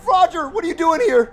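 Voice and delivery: normal talking voice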